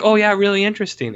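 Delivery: quick, high mock interest; fake interest. King pretending to listen before the throw, fast and mocking. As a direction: rattle off feigned interest. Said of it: "and he's like".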